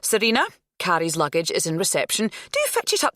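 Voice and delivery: put-on teacher hurrying girls to assembly, brisk and loud; brisk teacher orders. A narrator's reading. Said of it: "said Miss Rowlands".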